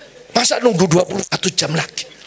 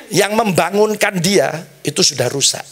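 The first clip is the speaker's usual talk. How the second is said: ordinary talk, nothing marked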